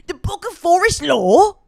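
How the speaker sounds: high, loud troll voice; cartoon troll. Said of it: "how does he talk?".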